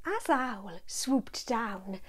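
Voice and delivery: plain reading voice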